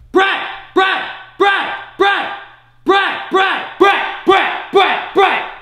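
very high, loud, identical yells; screaming the name. Shown as a demonstration. Coach testing a deafened player's hearing, repeated screaming.

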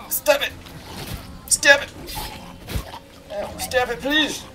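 high, pleading game yelps; high-pitched urging. Pretend. Player pleading with the character to stab, high-pitched urging. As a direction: beg in a high voice.

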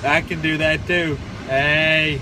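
loud sharp hey; loud call. Real, not acted. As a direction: shout it out. Echo game shouting.

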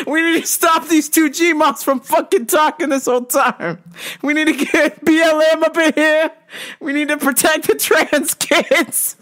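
rally the crowd like a protest leader; hammed-up call to action; mock activist rally. Mock rallying speech to audience.

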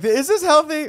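high, loud mock-worried voice; worried crew member. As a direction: pipe up as an alarmed crewman, comically high. Voicing worried laser crew in joke.

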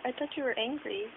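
ordinary delivery

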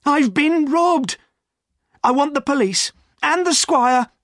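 high-pitched, loud desperate outcry; wild, distraught cry. A narrator's reading. Silas announcing the theft to the inn, frantic. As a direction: cry out for help in a frenzy. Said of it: "He waved his arms wildly as he spoke".

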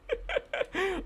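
soft, very high laugh. Real, not acted. Player amused by own adoption plea, soft high laughter. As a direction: giggle high and quietly.

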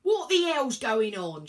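loud, slow shout into an intercom. A narrator's reading. Inspector shouts at the driver over the intercom. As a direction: yell down the line to the driver. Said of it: "shouted the ticket inspector".

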